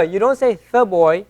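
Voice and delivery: ordinary delivery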